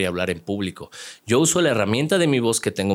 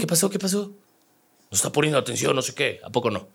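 scolding teacher; 6 semitones higher, faster than usual. Retold or imitated. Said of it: "teacher scolding student".

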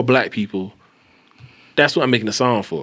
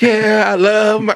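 mock singalong, high, loud and drawn out; 11 semitones higher, 6 dB louder, slower than usual. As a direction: belt it like a fan linking arms.

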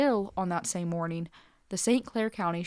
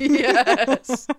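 laughing; 6 semitones higher, 11 dB louder than usual. Real, not acted. Laughing agreement.

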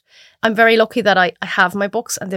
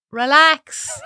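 high, drawn-out calming word to her past self; talking her panicking younger self down, soothing and high; soothing relax; 8 semitones higher than usual. Retold or imitated. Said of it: "and be like".

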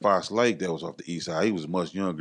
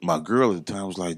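normal talking voice

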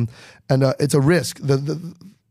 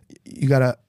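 usual speaking voice

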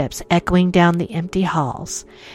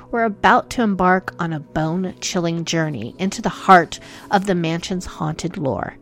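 ordinary talk, nothing marked

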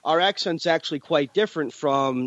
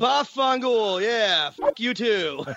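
higher, drawn-out Italian swearing; hammed-up Italian-American curse; 7 semitones higher, much slower than usual. New Jersey Italian playing up the slang, hammed up. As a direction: throw it out big and slow, mock Italian.

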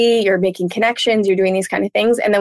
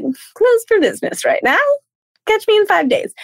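mock shutdown voice; 12 semitones higher than usual. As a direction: play a done-with-it version of yourself. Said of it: "you're like".

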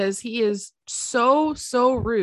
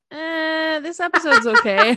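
mock meh; 8 semitones higher, 6 dB louder than usual. Mock unimpressed quote of both.

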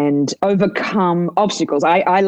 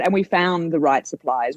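usual speaking voice

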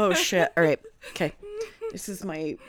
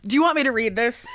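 ordinary talk, nothing marked

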